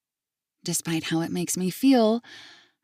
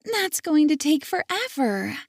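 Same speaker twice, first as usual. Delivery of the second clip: put-on much higher, let-down child; disappointed child; 8 semitones higher than usual. Girl let down by grandpa's answer, high. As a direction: sound crestfallen like a small girl. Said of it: "tone of disappointment".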